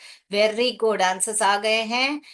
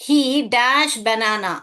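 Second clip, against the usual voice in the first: very slow, louder exercise sentence; slow emphatic reading; 5 dB louder, much slower than usual. Shown as a demonstration. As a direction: read it slowly and louder for pupils. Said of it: "reads the exercise sentence".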